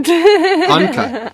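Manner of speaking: high laughing call of uncut. Real, not acted. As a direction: blurt it out through laughter. Laughing call while cutting.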